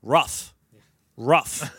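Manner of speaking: low, measured, carefully enunciated word; deadpan talking dog with perfect diction; articulate, well-spoken dog. Acted. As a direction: pronounce it precisely, like a refined dog. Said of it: "most articulate, well-spoken dog".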